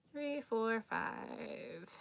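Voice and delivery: soft whispered count. Real, not acted. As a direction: whisper the last number.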